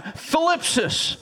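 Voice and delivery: thlipsis, high, with a lisped th; thlipsis, flip with a lisp, then sis; Greek word thlipsis. Shown as a demonstration. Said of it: "Say it one more time".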